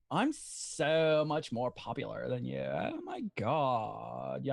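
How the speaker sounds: low, slow, drawn-out L.A. voice; East L.A. accent. Acted. Impressionist asked for East L.A., slow low L.A. accent. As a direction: stretch it out, L.A. popular-kid style. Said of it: "East L A".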